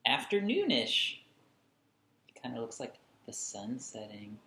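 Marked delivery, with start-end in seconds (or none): 0.1-1.2 much higher, sing-song finish; playful flourish. Acted. Reading to children, delighted sing-song ending. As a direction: finish the list with a delighted lift. Playful list of ish drawings.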